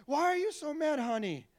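naive carefree. Retold or imitated. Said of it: "And I said".

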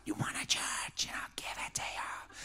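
exaggerated menacing whisper; hammed-up devil, all whisper and no voice. Shown as a demonstration. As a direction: whisper it like a cartoon devil tempting someone. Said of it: "you think the devil is, will speak like".

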